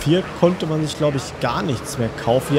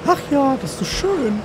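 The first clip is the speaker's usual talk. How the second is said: mock dreamy sigh; 12 semitones higher than usual.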